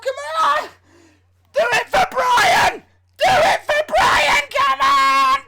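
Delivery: high, loud straining yells; straining shout. Pretend. Streamer shouts himself on while straining at the weights. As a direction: bellow yourself on through the rep.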